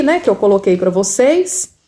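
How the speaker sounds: normal talking voice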